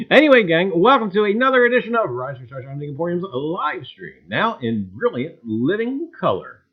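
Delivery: loud, high announcer welcome; show host intro. Acted. Host welcoming viewers to the stream, announcer style. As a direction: announce it like an old TV broadcast.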